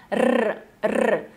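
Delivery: tongue-tip trilled "rrr", twice; rolled R trill. Shown as a demonstration. Teacher demonstrates the word-initial Spanish R, trilled. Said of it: "tremendo a língua no céu da boca".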